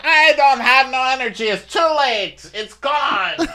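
squeaky lament, slowed down; Fatum voice. Retold or imitated.

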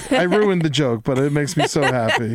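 ordinary talk

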